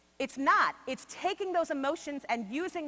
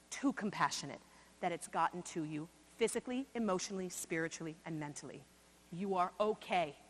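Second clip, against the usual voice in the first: reassuring; 6 semitones lower, 4 dB quieter than usual. Real, not acted. Speaker comforting the audience of teachers, gentle and reassuring. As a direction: ease their guilt gently, fading softer to the end.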